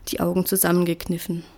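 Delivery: ordinary delivery, nothing marked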